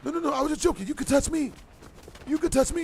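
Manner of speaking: high-pitched pleading imitation of a woman; mock begging. Girl insisting she was just joking, mock begging. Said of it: "Starts begging me to touch her".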